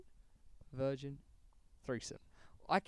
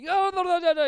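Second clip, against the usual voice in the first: much higher, louder insistent protest; Abdullah; 11 dB louder than usual. Retold or imitated. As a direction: cut him off, shrill and pushy. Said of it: "He talked over the door".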